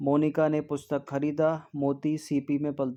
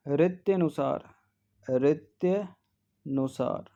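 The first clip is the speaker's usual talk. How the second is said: slow model word; much slower than usual. Shown as a demonstration. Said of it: "रित्यनुसार होता है".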